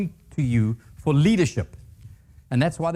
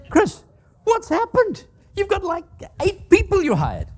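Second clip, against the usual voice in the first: much higher, pressing the finance guy; exasperated questioning. Retold or imitated. Speaker confronting finance guy over hires, incredulous higher voice. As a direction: demand an answer in a raised, incredulous voice.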